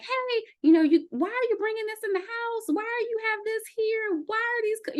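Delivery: high-pitched exasperated complaining; mock frustration. Imitating herself scolding partner about food, mock frustrated. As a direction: retell your annoyed outburst at him. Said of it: "I would get frustrated 'cause I'm like".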